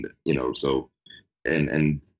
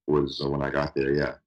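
usual speaking voice

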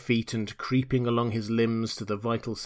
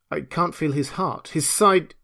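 raised pitch, growing louder; alarmed; 5 semitones higher, 4 dB louder than usual. A narrator's reading. Doctor examining the body, mounting alarm. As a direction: build alarm as you examine him. Doctor's shocked discovery in story.

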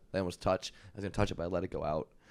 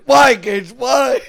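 high, loud shouted whine of an exasperated coach; mock coach yell; 23 dB louder, much slower than usual. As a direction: yell why at the player, pitched up. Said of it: "He'd be like".

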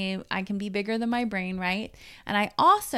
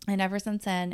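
ordinary talk, nothing marked

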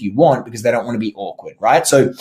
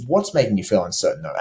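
low-key question; 5 semitones lower, 5 dB quieter, faster than usual. Shown as a demonstration. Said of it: "very low-key".

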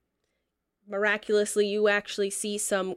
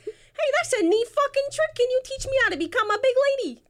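high, loud rat with a Jersey swagger; squeaky rat voice; 4 dB louder than usual. Acted. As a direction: squeak it like a wise-guy rat. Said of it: "he's like".

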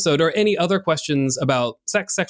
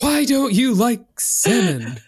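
drawn-out, high-pitched mock outrage; mock angry; 7 semitones higher, slower than usual. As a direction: whine it slowly as a hammed-up angry demand. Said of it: "They're just so angry about it".

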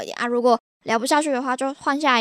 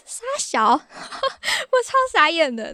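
slow, high, laughing it out; laughing disbelief; 7 semitones higher, much slower than usual. Real, not acted. Telling viewers about a weird chat, laughing in disbelief. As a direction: laugh through the words in disbelief.

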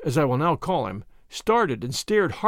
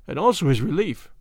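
ordinary delivery, nothing marked

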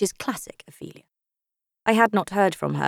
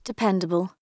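plain reading voice

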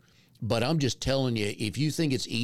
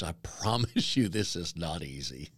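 laughing; 5 semitones lower, 4 dB quieter than usual. Real, not acted. Laughing admission to listeners.

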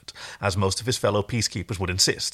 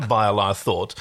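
ordinary talk, nothing marked

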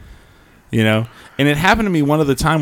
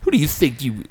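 mock entitled outrage; 2 semitones higher than usual. Man mocking himself ranting at airline staff, hammed-up indignation. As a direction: play the offended diva demanding special treatment. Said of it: "I'm not gonna go there like".

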